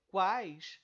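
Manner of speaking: quais loud and drawn out as one glide; one single syllable. Shown as a demonstration. Teacher proving it is one syllable, quais loud and unbroken. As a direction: stretch quais without breaking its vowels. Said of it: "tudo isso é uma sílaba só".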